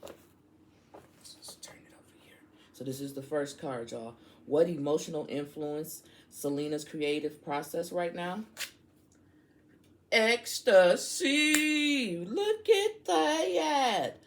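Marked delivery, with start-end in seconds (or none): 0.0-2.4 slow whisper while flipping the card; slow, soft whisper. Real, not acted. 10.1-14.2 high, slow drawn-out delight; much higher, stretched out and thrilled. Real, not acted.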